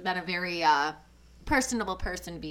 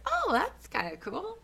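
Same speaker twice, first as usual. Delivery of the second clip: high, airy "oh, cool" voice; pleasantly surprised; 13 semitones higher than usual. Retold or imitated. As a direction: quote it with bright surprise. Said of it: "I was like".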